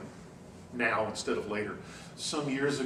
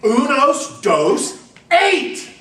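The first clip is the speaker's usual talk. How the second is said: tourist bellowing the numbers much higher and louder; shouting louder and slower; 13 semitones higher, 15 dB louder, much slower than usual. Retold or imitated. Tourist to waiter, shouting slowly and much louder. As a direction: yell it slowly, as if volume helps. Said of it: "say it louder and slower and louder".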